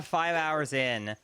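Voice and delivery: ordinary delivery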